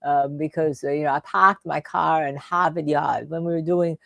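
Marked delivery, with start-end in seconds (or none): 1.1-3.3 R-less Boston speech; light imitation of a Boston accent. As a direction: drop every R like a Boston local. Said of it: "he didn't say any of his R's".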